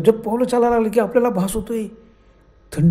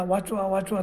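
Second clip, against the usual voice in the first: ordinary delivery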